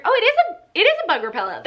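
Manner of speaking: high-pitched, delighted realisation; surprised. Real, not acted. Vlogger realising what the product really is, high surprised squeak. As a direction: squeak it out as it dawns on you.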